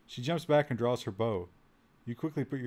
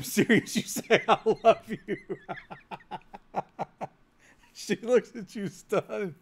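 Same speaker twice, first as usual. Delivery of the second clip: laughing while reading; 7 semitones higher, 6 dB louder, slower than usual. Real, not acted. Reading absurd romance line aloud.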